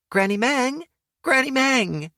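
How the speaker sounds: put-on a child's delighted greeting shout; girl calling gleefully to Granny Meng; happy calling out.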